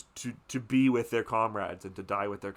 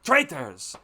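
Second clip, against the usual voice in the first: mock-furious, a bit higher and louder, ending in a loud "Traitors!"; furious rant; 5 semitones higher, 4 dB louder than usual. The father rants about the students, building to a shout. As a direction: spit out the betrayal. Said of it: "And he's, he's furious".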